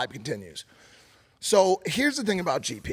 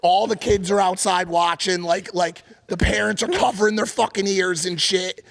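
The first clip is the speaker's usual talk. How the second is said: ordinary talk, nothing marked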